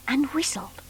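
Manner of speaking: hushed sneaky whisper. A narrator's reading. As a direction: breathe it secretly. Narrator drops to whisper.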